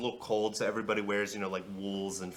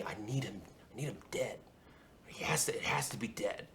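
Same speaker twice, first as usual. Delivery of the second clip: secretive whisper; 9 dB quieter, faster than usual. Acted. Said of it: "looking around for guards".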